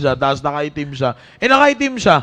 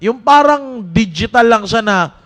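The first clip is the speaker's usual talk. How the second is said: raised, urgent preaching to the youth; 4 semitones higher, 4 dB louder than usual. Acted. Youth pastor exhorting Team Focus, preaching with force. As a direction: drive it home to the young people.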